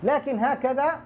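ordinary delivery

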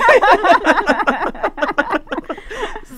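long, high laugh. Real, not acted. Laughs at spaghetti remark.

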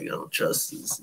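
hushed, breathy inner voice; whispered thought. Retold or imitated. Doubting his uncle to himself, whispered. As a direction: say it under your breath like a private suspicion.

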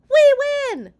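very high, very loud cheer; triumphant cry. A narrator's reading. Boy winning the pillow fight, crying out. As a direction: shout it in victory. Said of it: "cried Archie".

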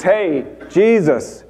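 mock him from the next cross, voice up high; taunting yell. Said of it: "He keeps yelling at Jesus".

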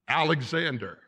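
imitated answer, spoken up on command. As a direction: give the name out audibly. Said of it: "Say it louder".

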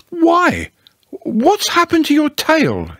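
high-pitched, startled question; surprised. A narrator's reading. Pooh noticing Eeyore's missing tail, surprised. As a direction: leap up high in astonishment. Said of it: "he said in surprise".

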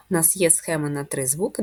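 usual speaking voice

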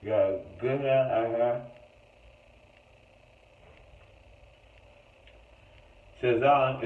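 talking while pressing teeth strips; fingers in mouth. Real, not acted. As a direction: lips held apart by hands. Seen 0: Both speakers press whitening strips onto teeth, fingers between lips.

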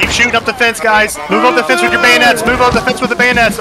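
high loud commands; shouting orders over battle. Real, not acted. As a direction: push the men forward. Leading charge along fence.

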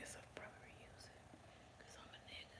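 faint breathy whisper. Real, not acted. Vlogger jokes to viewers about reusing it, whispering. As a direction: murmur the joke under your breath. Joking aside.

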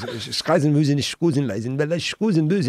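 rattled-off gibberish German; mock German lady. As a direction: imitate a stranger's incomprehensible German reply. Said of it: "some lady turns around and goes".

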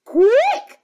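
high, very loud alarm; high-pitched urgent command; urgent order. Acted. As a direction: cry out in panic. Panicked servants, threatened with tears.

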